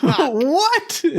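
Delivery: high-pitched incredulous squawk; hammed-up shocked cry; startled disbelief. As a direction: yelp it high, as if caught off guard. Startled reply to escape shout.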